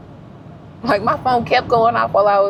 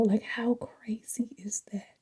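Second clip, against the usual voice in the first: awed whisper; 12 dB quieter, slower than usual. Real, not acted.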